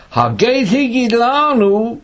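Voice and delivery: high, loud, slowly drawn-out recitation; declaimed scripture. Acted. Teacher reading Saul's reply to the class, declaimed. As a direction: proclaim the verse slowly and loudly.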